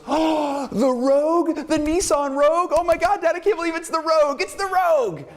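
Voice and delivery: put-on overjoyed teen squeal over a car; squealing with delight. Teen thrilled at getting the Rogue from Dad, hammed-up high squeal. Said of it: "and she goes".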